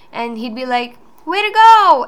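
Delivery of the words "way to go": loud, high cheer; dad cheering. Retold or imitated. Dad cheers his daughter's failure with a big high cheer.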